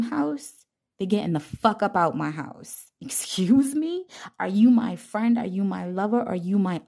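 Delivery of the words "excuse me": high, slow affronted question; sassy affronted excuse me; offended excuse me. Real, not acted. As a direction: call out the nerve of it. Offended rebuke.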